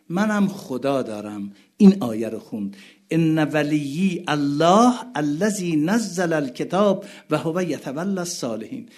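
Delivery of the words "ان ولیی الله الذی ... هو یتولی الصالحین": high, loud mourning recitation; impassioned preaching. Acted. Preacher crying out to the mourning gathering, impassioned. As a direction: cry it out to the mourners.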